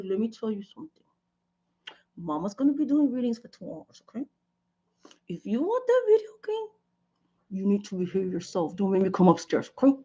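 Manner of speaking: a hint of a stern mom warning the kids; lightly voiced no-nonsense mother; stern mama. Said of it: "I do the brown mama thing".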